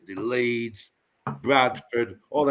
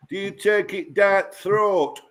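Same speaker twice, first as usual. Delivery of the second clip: slow mocking Yorkshire voice; sneering Yorkshire yokel; Yorkshire accent; 7 semitones higher, slower than usual. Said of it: "That's what they say".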